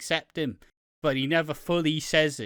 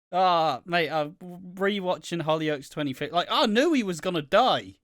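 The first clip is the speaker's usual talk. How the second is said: ordinary talk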